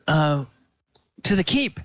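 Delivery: a nervous man repeating himself; high flustered stammer; flustered NPC. Acted. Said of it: "he's like".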